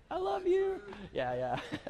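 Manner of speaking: high laughing I love you; laughing apology. Real, not acted. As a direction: laugh it off to City Hall. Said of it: "please, I apologize".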